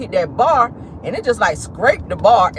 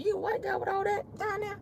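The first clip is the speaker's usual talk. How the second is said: mock gasp, pitched well up but much softer; shocked disbelief; 14 semitones higher, 13 dB quieter than usual. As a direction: play the stunned, hushed double take. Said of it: "I was like".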